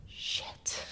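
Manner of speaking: breathy whispered curse. Retold or imitated. Retelling her shock, a whispered curse. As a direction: mutter it under your breath. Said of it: "and I was like".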